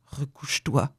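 put-on soft, unsure old man; unsteady. A narrator's reading. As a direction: say it quietly, without assurance. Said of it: "lui dit-il d'un ton mal assuré". (ton mal assuré)

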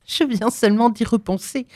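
high, nervous woman's voice. A narrator's reading. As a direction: blurt it out on edge. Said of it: "dit-elle nerveusement".

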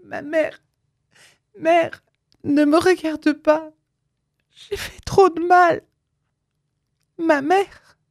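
high, anguished, slowed; heart-rending cry. A narrator's reading. Lisette cries out in anguish to her mother. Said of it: "d'une voix déchirante".

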